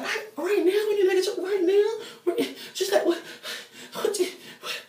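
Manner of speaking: very high squeak cracking into laughter, fading to a whisper; squeaky laughing mock. Mocking Chanel's panicked choice, squeaky and laughing. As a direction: squeal it out while losing it laughing.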